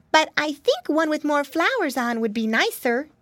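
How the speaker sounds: put-on high little-child piglet voice; baby piglet voice. A narrator's reading. Baby Pinky advises her mama, in a high child voice. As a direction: pipe up high as the baby pig. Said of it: "cried baby Pinky".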